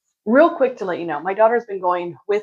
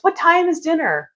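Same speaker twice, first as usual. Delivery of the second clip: mock sister; 9 semitones higher than usual. Mocking sister's quick question.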